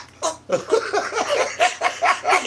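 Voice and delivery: high wheezing laugh; helpless laughter. Real, not acted. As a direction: lose it laughing mid-story. Laughing hard while telling story.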